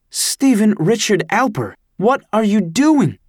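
a mother yelling at her son over his shaved head, angry and high; angry mom. A narrator's reading.

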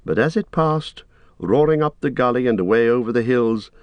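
plain reading voice

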